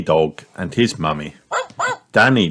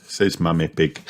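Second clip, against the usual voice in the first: usual speaking voice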